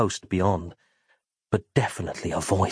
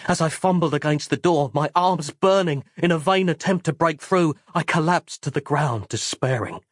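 usual speaking voice

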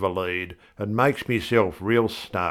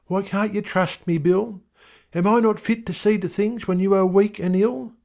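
much higher wife voice; an indignant wife, higher; wife's voice; 12 semitones higher than usual. A narrator's reading. Wife scolding husband Bill, much higher.

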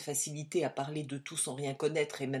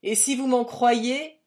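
slow, loud and higher threat; loud slow ranting threat; 6 semitones higher, 9 dB louder than usual. A narrator's reading. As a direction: lean in and declaim it loudly. Agent ranting at the room.

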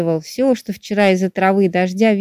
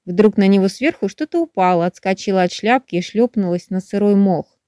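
ordinary delivery, nothing marked

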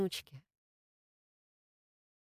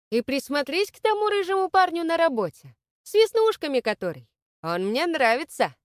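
much higher and louder call; 9 semitones higher, 7 dB louder than usual. A narrator's reading. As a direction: shout it after someone leaving. Grandma calls after leaving granddaughter.

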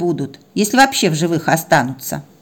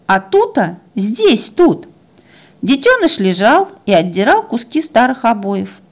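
usual speaking voice

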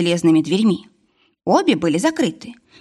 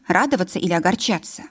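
usual speaking voice

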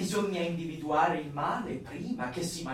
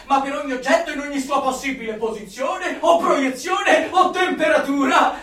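higher, loud, near-shouting rapture; ecstatic zeal; 6 semitones higher, 7 dB louder than usual. Acted. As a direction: preach it like fireworks going off. Zealot listing endless word variations.

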